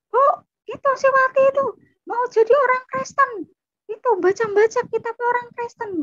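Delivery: high, loud, fast outraged shouting; tattling yell. Retold or imitated. Older sibling tells mother on younger one, high frantic yelling. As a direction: yell for mother to come and know. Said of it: "Nggak usah teriak-teriak".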